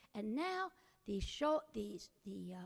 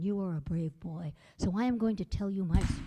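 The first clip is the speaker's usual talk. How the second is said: lower, louder bony voice; skeleton voice; 6 semitones lower, 8 dB louder than usual. A narrator's reading. Skeleton tells Jack he is brave, lower and louder. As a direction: speak low and grand to the boy. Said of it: "said the skeleton".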